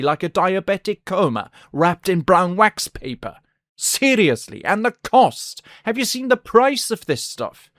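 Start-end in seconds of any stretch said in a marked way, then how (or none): none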